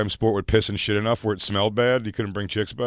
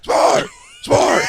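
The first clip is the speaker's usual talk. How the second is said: loud high mock yell of the name; calling the dog; 10 dB louder, much slower than usual. Owner yelling for his escaping dog. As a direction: yell after the runaway dog. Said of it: "he goes".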